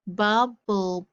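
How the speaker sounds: usual speaking voice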